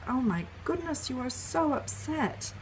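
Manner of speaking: put-on gently name the upset toddler's feeling; soothing empathy. Shown as a demonstration. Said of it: "it might be something like".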